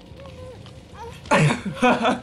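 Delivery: high-pitched giggle; high laugh. Real, not acted. Laughing at survivors' plight.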